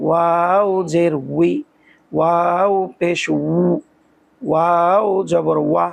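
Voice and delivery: slow, rounded "উই", "উ", "ওয়া"; teacher repeating the drill. Shown as a demonstration. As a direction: round the lips and say each syllable slowly. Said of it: "আবার খেয়াল করুন".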